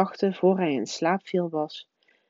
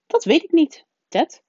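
hoge, luide stem van een weerman; 10 semitones higher, 5 dB louder than usual. A narrator's reading. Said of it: "zei de weerman". (weerman met hoge stem)

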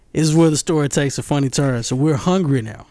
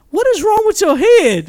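high-pitched mock alarm; squeaky, incredulous scolding; shocked sister. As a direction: imitate an appalled sister, voice way up.

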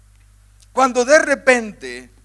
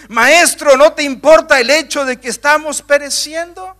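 high, loud reproach, each Maestro pressed; reproachful cry; 5 semitones higher, 6 dB louder than usual. Acted. Preacher voicing disciples reproaching Jesus, high and loud. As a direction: accuse him while begging for help. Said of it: "un reproche".

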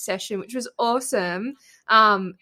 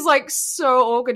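normal talking voice

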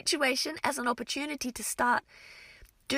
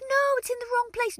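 put-on protesting kids; 11 semitones higher, 3 dB louder than usual. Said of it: "They're like".

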